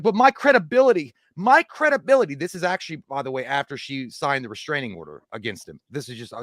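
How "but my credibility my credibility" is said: high, loud whiny mockery; whiny mock wife. Mocking the wife on the phone, high whine. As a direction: whine it way up like a spoiled complaint. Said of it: "she's like".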